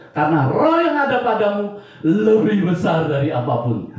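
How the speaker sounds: high, loud pulpit proclamation; impassioned preaching. Real, not acted. Preacher vows to serve God wholeheartedly, preaching with full force. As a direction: declare it forcefully to the congregation.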